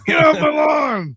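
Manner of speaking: put-on loud, high old-man holler; hammed-up old geezer shout. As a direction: yell it like a cranky old man, playing along. Echoes mock old man shout.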